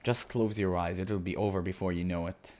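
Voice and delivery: ordinary talk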